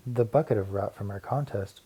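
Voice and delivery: usual speaking voice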